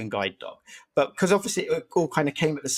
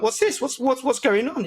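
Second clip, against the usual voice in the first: higher-pitched mock disbelief; retold bewilderment; 9 semitones higher than usual. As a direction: replay being baffled by a surprise, voice lifted. Startled owner finding dog on bed.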